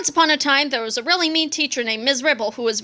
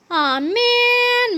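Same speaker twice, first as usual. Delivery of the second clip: put-on high, whiny childish groan; 11 semitones higher than usual. A narrator's reading. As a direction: drag it out like disappointed kids.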